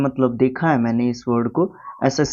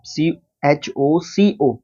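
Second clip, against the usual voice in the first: slow spelling out; much slower than usual. Shown as a demonstration. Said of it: "choco से याद रखेंगे आप".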